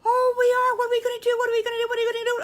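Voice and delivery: very high, loud squealing piglets; excited squeal. Acted. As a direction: shriek with delight. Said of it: "so excited".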